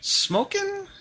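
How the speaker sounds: high incredulous squeak; surprised. Real, not acted. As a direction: blurt it out in disbelief. Disbelief at friend's word.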